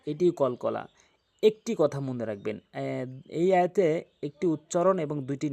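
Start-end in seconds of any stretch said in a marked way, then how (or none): none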